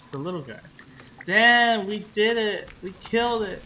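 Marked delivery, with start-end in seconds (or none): 1.3-3.6 excited. Real, not acted. Victory cheer.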